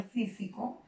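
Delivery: "físico" stressed on its first syllable, fí. Shown as a demonstration. Teacher showing students where físico carries its accent, stress on fí. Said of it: "Si la sílaba de mayor énfasis es".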